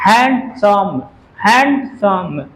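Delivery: हैंडसम slowly broken up, हैं-ड-सम; syllable by syllable. Shown as a demonstration. Teacher shows the class its syllables, हैंडसम pulled apart slowly. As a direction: sound out हैंडसम in slow, separate beats. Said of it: "ऐसे तीन syllable में बोला जा रहा है".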